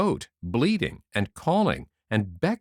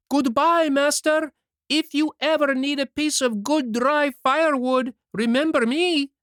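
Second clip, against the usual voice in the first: even louder, much higher call from farther off; 4 dB louder than usual. A narrator's reading. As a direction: throw the goodbye even harder across the water. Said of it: "called louder than before".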